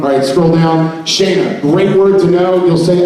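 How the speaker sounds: ordinary talk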